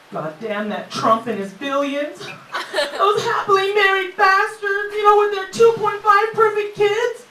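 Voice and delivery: high, loud mocking rant; mock trash talk. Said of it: "now my shit talking sounds like".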